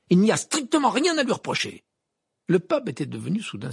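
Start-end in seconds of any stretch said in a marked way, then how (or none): 0.1-1.8 high, loud indignation; angry protest. A narrator's reading. Harry insists Hagrid is blameless, indignant. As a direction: defend a friend heatedly.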